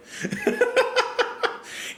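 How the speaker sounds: high-pitched real laugh. Real, not acted. Reactor laughing after saying get outta here, high laugh.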